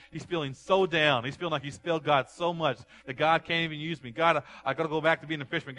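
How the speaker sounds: loud, high, rising preacher's cry; fiery pulpit preaching; preaching at full force. Acted. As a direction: build the sermon to a shout.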